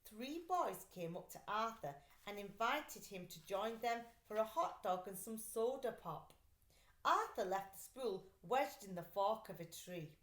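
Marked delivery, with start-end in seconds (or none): none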